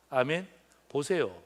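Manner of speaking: usual speaking voice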